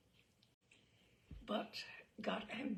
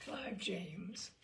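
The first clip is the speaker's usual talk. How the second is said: ordinary delivery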